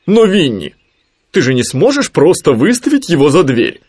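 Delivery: high, loud protest; exclaiming Owl. A narrator's reading. Owl objecting to Winnie about Rabbit, exclaiming. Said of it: "воскликнула сова".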